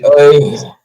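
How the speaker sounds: loud imitated "oh" of arousal; exaggerated lusty "oh"; mock sexual groan. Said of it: "doing the sexual".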